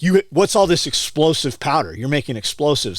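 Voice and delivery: hammed-up agent pouncing on the powder; mock accusing agent. Agent accuses the speaker of making explosives, loud and high.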